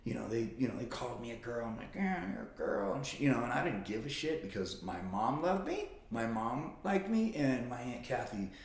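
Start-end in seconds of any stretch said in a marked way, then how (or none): 1.9-2.9 high-pitched jeering imitation; mock sibling taunt. Brothers calling him a girl, taunting. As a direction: tease in a put-on high voice.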